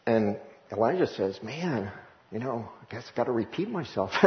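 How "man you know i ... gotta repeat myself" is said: a soft, tired mumble of a sigh; weary aside. Retold or imitated. Preacher voicing Elijah tired of repeating himself, quietly. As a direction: play Elijah fed up and muttering it.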